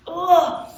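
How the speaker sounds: big, loud burst of "ugh"; acted-out gut reaction, blurted loud; loud exclaimed ugh. Acting out her reaction to Chuck.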